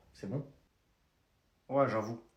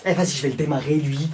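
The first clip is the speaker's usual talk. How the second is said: ordinary talk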